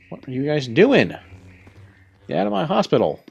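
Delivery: high-pitched playful telling-off; mock scolding. Scolding game enemies that invaded hospital.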